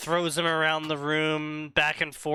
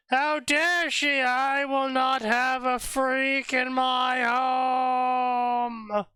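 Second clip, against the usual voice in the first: Vernon screaming; 9 semitones higher, much slower than usual. Retold or imitated. Vernon refusing the freak baby, slow high scream. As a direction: scream it out at the top of your lungs. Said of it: "Vernon screams at the top of his lungs".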